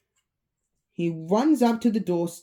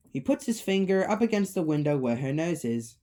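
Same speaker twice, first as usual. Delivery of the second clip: ordinary delivery, nothing marked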